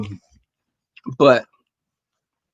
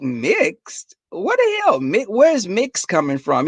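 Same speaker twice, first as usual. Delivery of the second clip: higher, incredulous squeal at the word mixed; retold disbelief; 6 semitones higher, slower than usual. Retelling her reaction to her friend, incredulous. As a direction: act shocked like you can't believe it. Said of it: "And I'm like".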